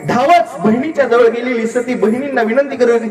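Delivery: usual speaking voice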